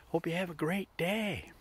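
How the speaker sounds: gentle sign-off; soft farewell. Acted. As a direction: ease the listener into the day. Sign-off wish.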